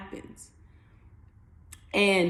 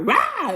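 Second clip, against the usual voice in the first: short high playful roar; mock fiery roar; 12 semitones higher, 3 dB louder than usual. Playing fiery Aries with a quick mock roar. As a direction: roar like a fire sign.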